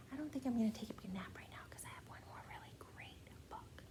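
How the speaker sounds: fast unvoiced whisper. Real, not acted. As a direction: whisper it like a secret at bedtime. Hushed aside to child.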